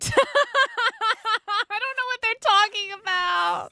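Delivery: high, loud, slow laugh-through line; laughing helplessly. Real, not acted. Streamer laughing at chat's questions, high cracking laugh. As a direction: laugh while you protest, voice cracking up.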